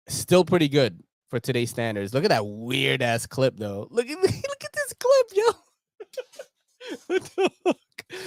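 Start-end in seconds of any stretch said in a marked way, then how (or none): 3.9-7.1 laughing. Real, not acted. Laughing at an old knife clip. 7.1-8.2 laughing. Real, not acted. Laughing at an old design.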